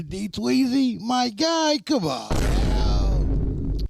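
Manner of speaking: high, loud exasperated plea; drawn-out disbelieving come on, man; pleading with a friend in disbelief; exasperated call-out. Real, not acted. Mock outrage at friend not knowing.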